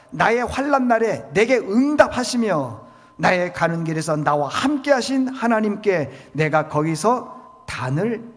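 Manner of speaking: loud, proclaiming Bible reading, slowing at the end; a preacher reading Genesis thirty-five aloud, loud and proclaiming; scripture read at full force. Acted. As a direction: declare the verse to the congregation. Reads the verse aloud with force.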